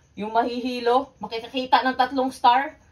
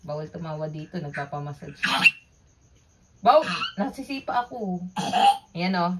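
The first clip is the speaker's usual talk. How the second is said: ordinary delivery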